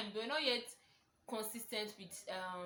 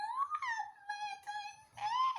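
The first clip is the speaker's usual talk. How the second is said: very high, slow, melting "oh"; swooning delight; slower than usual. Retold or imitated. Thanking her boyfriend for the Valentine's wish, swooning. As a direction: melt at the surprise wish.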